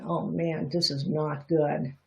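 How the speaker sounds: normal talking voice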